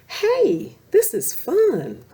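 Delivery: high, loud gleeful cry; young rabbit thrilled on the logs; excited shout. Acted. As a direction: shout it out with joy. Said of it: "Thumper shouted".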